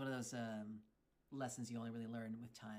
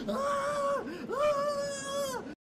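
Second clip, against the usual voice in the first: screaming; 16 dB louder than usual. Real, not acted. Coaster scream again.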